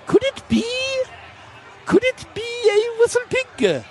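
very high, hammed-up French accent. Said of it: "he goes in his French accent".

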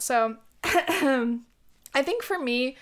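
hoarse, voice cracking; losing voice. Real, not acted. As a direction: voice giving out, throat catching. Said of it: "I think I'm losing my voice".

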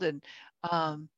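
normal talking voice

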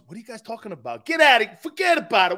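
wife hamming up a Boston "forget about it"; hammed-up New England accent; mock Northeast accent. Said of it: "and then my wife goes".

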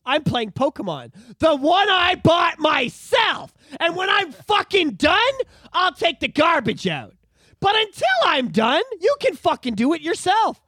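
high, loud and slow bratty shout; bratty kid yelling back. Retold or imitated. Nine-year-old yelling at his parents over chores, bratty shout. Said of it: "I'd be like".

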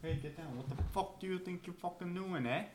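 put-on Australian accent. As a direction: scold the dog in put-on Australian. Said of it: "You gotta speak to it in Australian".

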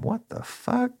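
very high, squeaky disbelief; shocked disbelief. Retold or imitated. Cop staring at burned man, squeaky shocked thought.

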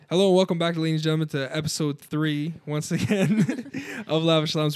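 upbeat announcer welcome; bright show opener; podcast host intro. Acted.

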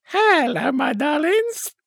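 high-pitched comic catchphrase greeting; high impression, drawing out the last word. Comedian greeting a panto audience, high-pitched impression. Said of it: "That was my [name] impression".

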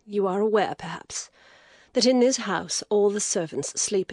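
an exhausted lady, reluctant; weary lady. Acted. Lady continues, subdued.

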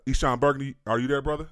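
upbeat host hailing a guest; calling out. Real, not acted. Host calling guest onto the line.

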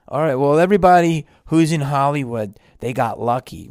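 loud, insistent objection. Acted. A skeptic insisting that actors just got lucky, loud.

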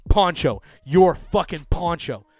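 loud, drawn-out, high; emphatic mock yell; retold insistent call-out. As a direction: hammer the words out slowly and loudly. Retold insistent repeat of nickname.

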